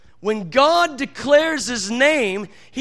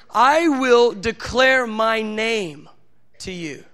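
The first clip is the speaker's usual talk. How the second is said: solemn slow reply; much slower than usual. Retold or imitated. God's reply, repeated.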